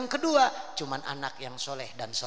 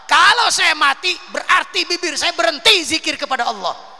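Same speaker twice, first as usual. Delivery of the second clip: high, loud cry of refusal; loud pleading refusal; 14 semitones higher, 14 dB louder than usual. Acted. Prophet Idris refusing the angel of death, loud and pleading. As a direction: beg loudly not to be taken. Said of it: "Nabi Idris ngomong".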